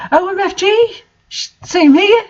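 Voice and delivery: hammed-up shriek of joy; mock shriek. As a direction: shriek like a fangirl. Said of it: "I shrieked".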